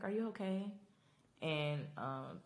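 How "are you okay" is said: high, gentle asking voice; concerned Gabriel. Retold or imitated. Gabriel gently asking Rosita if she's okay, high concerned voice.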